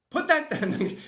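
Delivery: sharp scolding. Pretend. Scolding a human eating off the ground, sharp and loud. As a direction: snap at a child.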